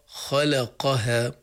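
خلقها said very slowly, with a heavy خ, a light ل, a heavy ق and a light ها; خلقها with its letters alternating heavy and light. Shown as a demonstration. Teacher slowly modelling the emphasis pattern in خلقها. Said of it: "هنا فيها تفخيم".

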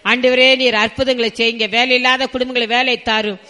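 loud, high, impassioned pleading prayer; fervent prayer. Real, not acted. Pastor calls heaven's fire down on the congregation, fervent prayer. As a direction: cry out to God in rolling, repeated appeals.

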